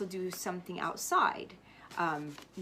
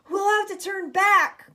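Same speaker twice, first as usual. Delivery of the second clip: higher, louder, slow called warning; calling out; 12 semitones higher, 12 dB louder than usual. A narrator's reading. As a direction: call it across the deck. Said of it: "called another".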